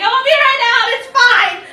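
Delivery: high, loud call through a door; sing-song loud reassurance through the door. Retold or imitated. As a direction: call out brightly to people knocking. Calling through bathroom door.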